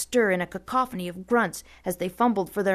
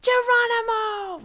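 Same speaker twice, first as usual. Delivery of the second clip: very high, loud and slow held cry; drawn-out battle whoop; 5 dB louder, slower than usual. A narrator's reading. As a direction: stretch the whoop out as you run. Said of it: "Rusty replied".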